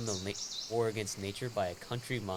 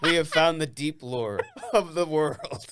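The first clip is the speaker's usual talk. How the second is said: loud, high, slow through laughter; laughing revelation; 6 semitones higher, 9 dB louder, slower than usual. Real, not acted. Friend joking about discovered lore, loud laughing proclamation. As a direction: proclaim it slowly while cracking up.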